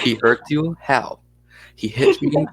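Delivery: lightly acted Russian-accented exchange; hint of a Russian accent. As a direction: question and answer in a Russian accent. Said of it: "you like the Russian accent".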